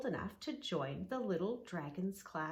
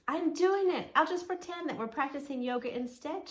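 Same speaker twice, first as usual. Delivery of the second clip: high, louder and fast with delight; thrilled it finally works; excitedly; 8 semitones higher, 7 dB louder than usual. Acted. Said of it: "she said excitedly".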